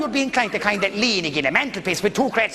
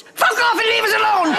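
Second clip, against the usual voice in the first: high, loud punchline shout with a laugh; mock yell; 11 semitones higher, 4 dB louder than usual. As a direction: yell it at the policeman, higher and louder. Nun punchline yelled at policeman.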